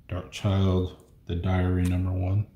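usual speaking voice

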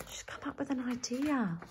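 whispered wonder to the listener. Real, not acted. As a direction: hush and whisper it. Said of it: "whispered aside".

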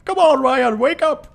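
high, loud, disbelieving shout at Ryan; shrieking frustration at a teammate's miss; exasperated yell. Real, not acted. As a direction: yell at him to wake up. Teammate blew an easy shot.

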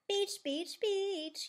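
high gleeful chant; excited yell. A narrator's reading. Plastic too excited to listen, high chanting yell. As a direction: chant it out high like an overexcited child. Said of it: "yells Plastic".

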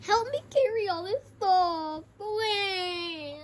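slow, high whimpering plea; whiny plea. Pretend. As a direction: whine like it's too heavy. Whining about carrying snacks.

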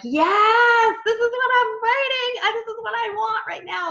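high, loud, delighted yes; excited gush. Retold or imitated. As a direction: relive the thrill of finding the right book. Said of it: "it just got me so excited again".

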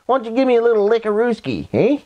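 high-pitched playful greeting; playful pet talk. Real, not acted. Greeting a pet and asking for a lick, high playful pet talk. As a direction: coo to a pet in a high, teasing voice.

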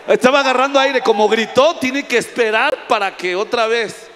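shouting over the room, half joking; loud joking call. Real, not acted. Preacher shouting to congregation to let her breathe.

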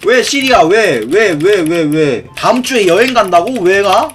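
hammed-up clingy whys, stretched out; mock pleading. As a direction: beg them not to go, building to a shout. Pleading with a friend who is leaving.

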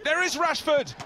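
loud, high burst on Scores; excited goal call. Real, not acted. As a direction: punch out the goal. Goal called live.

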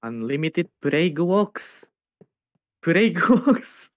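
slow, drawn-out rising attack name; mock incantation. Co-streamer naming the streamer's plague power, slow mock incantation. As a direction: intone it like an anime special move.

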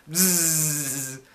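buzzing like a bee. Retold or imitated. Showing viewers how the bee went, a buzzing noise. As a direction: imitate a bee's drone with the lips. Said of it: "it went like this".

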